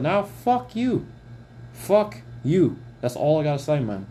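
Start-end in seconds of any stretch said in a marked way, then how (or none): 0.0-2.8 slow, heavy fuck you; angry. Real, not acted. Vlogger cursing out a former friend, angry and slow. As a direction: spit it out at the man who wronged him. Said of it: "I'm very angry right now".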